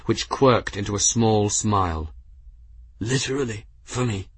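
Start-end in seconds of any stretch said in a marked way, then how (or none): 3.0-4.3 a hint of an injured monk, slightly raised pitch; lightly voiced dying man, quietly wry; wounded monk. A narrator's reading. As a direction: softly voice the hurt monk. Injured monk speaking after coughing blood.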